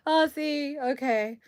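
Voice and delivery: ordinary talk, nothing marked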